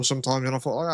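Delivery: ordinary talk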